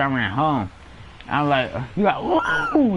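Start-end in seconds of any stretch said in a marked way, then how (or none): 2.2-3.0 mock Hulk scream.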